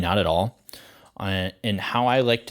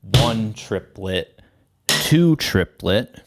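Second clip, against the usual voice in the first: slow, rising and louder on "two"; "one triplet, two triplet", slow with "two" rising; slow triplet count; 6 dB louder, much slower than usual. Shown as a demonstration. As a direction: count it out slowly, pushing "two". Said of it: "so that would be".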